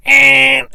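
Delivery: squeaky taunting eh; a high, loud taunting eh; mocking high eh. Mocking noise at the washer.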